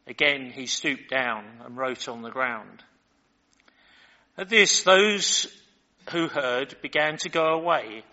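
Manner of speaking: measured, deliberate Bible reading voice; scripture reading. Acted. Pastor reads the Gospel passage to his congregation, measured reading.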